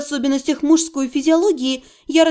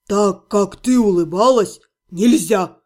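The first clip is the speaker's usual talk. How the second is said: barked; 6 semitones lower than usual. A narrator's reading. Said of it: "рявкнул Отто".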